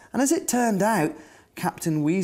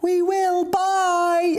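excited little bunnies calling; 12 semitones higher, much slower than usual. Acted. Little bunnies calling goodbye to their families, high and excited. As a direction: squeal it out like eager children. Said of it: "Everyone called out".

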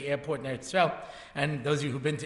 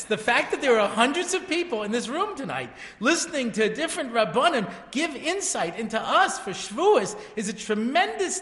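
higher, louder, fervent praise of the crowd; rousing praise; 5 semitones higher, 5 dB louder than usual. Real, not acted. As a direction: lift the room up with fervor.

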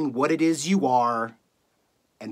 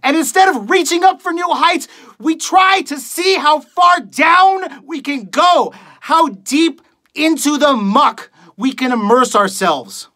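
impassioned rant climbing in pitch; scornful tirade; 2 semitones higher than usual. Acted. Radio host berates millions of listeners for their fear, scornful tirade. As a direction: build from grim address to raised-voice outrage. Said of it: "reading a monologue for you guys".

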